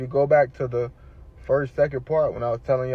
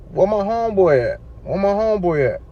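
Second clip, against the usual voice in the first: high, louder retold demand; insistent questioning; 8 semitones higher, 5 dB louder than usual. Patient asking hospital staff about his friend, insistent questioning. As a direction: press them for an answer.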